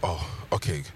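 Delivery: low relieved. Acted. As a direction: settle back, relieved. Relieved after misunderstanding.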